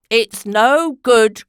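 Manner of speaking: slow loud protest; old lady crying out. A narrator's reading. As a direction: call it out, drawn and loud. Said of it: "cried Missus Trumbull".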